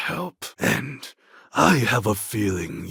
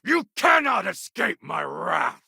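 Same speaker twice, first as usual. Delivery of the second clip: battle taunt; 12 semitones higher than usual. Acted. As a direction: gloat at a fleeing enemy. Combat taunt at fleeing foe.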